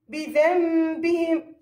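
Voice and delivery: slow بذنبهم, humming m where the ن is written; iqlab with ghunna. Shown as a demonstration. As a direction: hold the nasal m in بذنبهم for two counts. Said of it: "مع وجود غنة".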